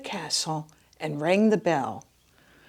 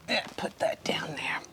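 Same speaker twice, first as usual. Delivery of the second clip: soft whispered aside; muttered aside; 7 dB quieter than usual. Real, not acted. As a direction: mumble it to yourself. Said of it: "aside to herself".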